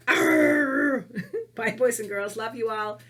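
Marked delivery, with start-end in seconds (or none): 0.1-1.1 loud, very low playful roar; bear growl. Pretend. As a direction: growl like a big bear for children. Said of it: "great big bear cheer".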